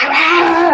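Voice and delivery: put-on high, loud snapping "Ah!" of an old lady biting back; angry outburst. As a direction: imitate a cranky old woman lashing out with one cry. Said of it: "she really bit back and she was like".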